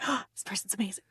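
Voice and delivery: whispered, starstruck; awed whisper. Retold or imitated. Fan meeting a favourite poet, an awed whisper. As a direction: breathe it like meeting a rock star. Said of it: "who you're like".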